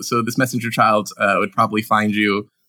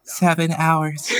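put-on creepy child voice; 3 semitones higher, much slower than usual. Creepy child appears again.

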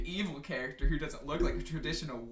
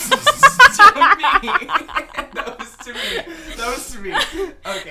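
laughing; 9 semitones higher, 17 dB louder, slower than usual. Real, not acted. Cracking up at own joke.

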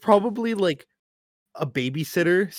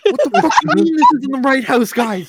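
disbelieving outrage; 9 semitones higher, 5 dB louder than usual. Pretend. Friend on phone, incredulous yelling.

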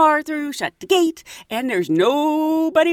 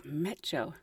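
low, much softer baffled echo; puzzled murmur; 8 semitones lower, 15 dB quieter than usual. Retold or imitated. Couple looking at each other over the guard's word, quiet baffled echo. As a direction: repeat the strange word to each other, confused.

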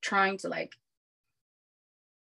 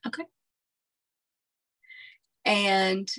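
normal talking voice

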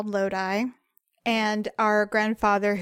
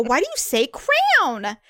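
slow, higher exasperated question; 4 semitones higher, 3 dB louder, slower than usual. Retold or imitated. Challenging her partner's word, slowly exasperated. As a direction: drag out "crown" in exasperation. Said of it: "I go".

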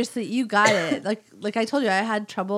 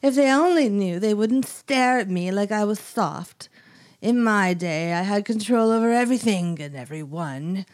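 put-on weird British-Italian accent. Scary old lady killer's soap opera monologue, weird British accent. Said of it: "it was like a weird British".

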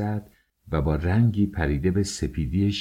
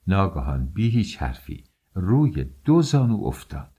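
ordinary delivery

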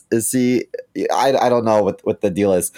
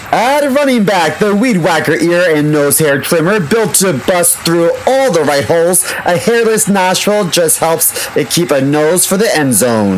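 ad read; 7 semitones higher, 6 dB louder than usual. Acted. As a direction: announce the bundle like a big reveal. Ad read as football lineup.

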